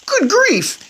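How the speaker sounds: high, loud gasp of alarm; suddenly sees his own paws; shocked cry. Acted. Bruno discovering his paws, a high shocked cry. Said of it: "he cried".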